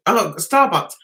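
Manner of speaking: ordinary talk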